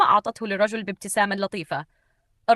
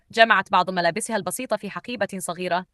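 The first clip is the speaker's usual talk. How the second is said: plain reading voice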